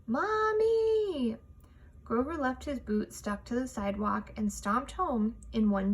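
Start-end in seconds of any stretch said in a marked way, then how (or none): 0.1-1.4 high, loud, long call of Mommy!; a child's frustrated shout for his mother; Grover's high, loud, frustrated wail for his mommy; yelling for mommy. A narrator's reading. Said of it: "he yelled".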